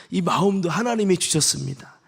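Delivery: ordinary talk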